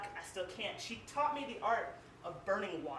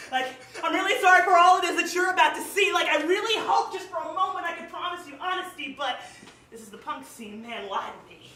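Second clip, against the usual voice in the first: passionate slam poetry delivery, louder; spoken word poem; 4 semitones higher, 9 dB louder than usual. Acted. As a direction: perform it like a slam poet on stage.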